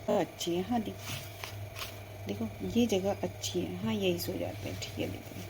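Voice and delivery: soft drowsy murmur, fading to a whisper; sleepy girls lying down by the pond. Acted. (नींद भरी धीमी आवाज़)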